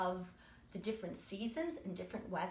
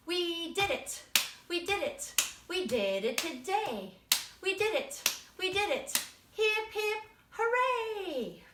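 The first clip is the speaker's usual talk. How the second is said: cheering; 8 semitones higher, 5 dB louder than usual. Acted. Teacher cheering the class.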